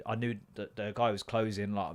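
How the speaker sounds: ordinary talk, nothing marked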